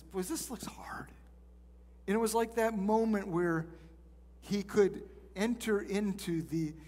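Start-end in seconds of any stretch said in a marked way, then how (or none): 0.0-1.1 soft unvoiced whisper, full of sympathy. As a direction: breathe it gently to the worried father. Jesus gently sympathizing with father.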